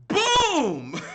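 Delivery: huge, high, drawn-out shout; explosive boom. Real, not acted. Chess teacher springing the winning move, explosive shout. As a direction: let it burst out long and loud.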